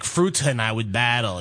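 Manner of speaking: ordinary talk, nothing marked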